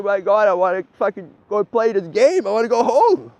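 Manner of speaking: high, loud whining complaint; hammed-up tearful whine; whiny mock crying. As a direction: imitate a fed-up player begging to go home. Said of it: "and they're crying".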